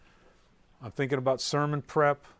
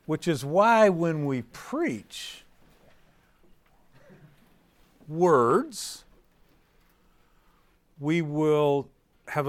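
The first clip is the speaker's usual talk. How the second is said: slow, louder preaching build; preaching emphasis; 3 semitones higher, 5 dB louder, much slower than usual. Real, not acted. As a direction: drive the point home to the room. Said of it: "preaching climax".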